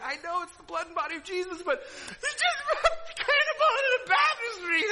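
re-enacted helpless giggles, voice soaring high; crying with laughter. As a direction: squeak it out through tearful laughter. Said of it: "I'm crying from it".

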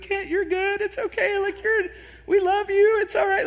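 high, sweet comforting group voice; mock reassurance. Connection group consoling Kent, high sweet mock reassurance. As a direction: coo it like kind friends consoling. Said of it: "wanting to be like".

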